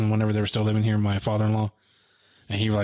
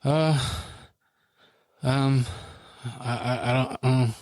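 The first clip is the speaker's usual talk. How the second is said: struggling to speak; 3 semitones higher, slower than usual. Retold or imitated. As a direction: grope for words between long pauses, as if unable to answer. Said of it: "struggling to, like, you wanted to say words".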